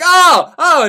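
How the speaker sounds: pained cry. Retold or imitated. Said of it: "the guy is like".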